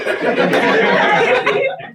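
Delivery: big burst of laughter; loud laugh. Real, not acted. Laughs at own joke.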